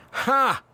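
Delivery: high-pitched imitated "ha" punchline laugh; one short, high mock laugh; classic "Ha!". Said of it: "the classic".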